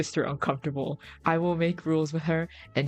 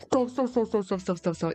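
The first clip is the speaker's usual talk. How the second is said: flustered repeated stop; 4 semitones higher than usual. Real, not acted.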